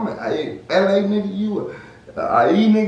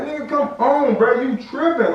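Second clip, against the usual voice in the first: urgent call-out; 8 semitones higher than usual. Retold or imitated. Urging cousin to leave.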